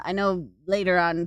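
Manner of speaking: usual speaking voice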